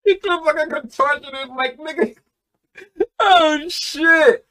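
very high, squeaky laughing disbelief; cracking up. Real, not acted. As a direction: squeal it out through real laughter. Cracking up at the lyric.

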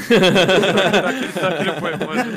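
words through laughter; laughing. Real, not acted. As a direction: laugh while saying it.

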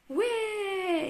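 high, held "whee"; long high-pitched cry of delight; gleeful exclamation. A narrator's reading. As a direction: squeal like you're taking off in a plane. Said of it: "esclamò Teddy dal biplano".

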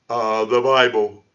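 mock a stumped critic answering; sheepish answer. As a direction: drag it out, hesitant. Acted stumped critic answering his question.

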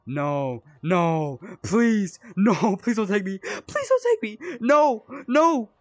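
high, slow, loud wailing plea; mock pleading wail. Player begging Krampus not to take him, hammed-up wail. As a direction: beg wailing, half laughing.

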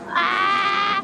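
high, sustained "ah" cry; startled cry. Real, not acted. Player startled in the shower room, high startled cry. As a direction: let out a drawn-out startled cry.

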